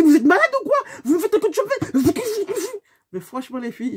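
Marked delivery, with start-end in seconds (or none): 0.0-2.0 much higher, loud outraged shout; furious yell. Retold or imitated. Shouting at the driver who cut her off, furious. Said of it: "J'ai dit".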